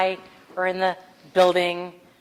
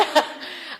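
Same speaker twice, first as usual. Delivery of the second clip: breathy laugh. Real, not acted. Laugh alone on line.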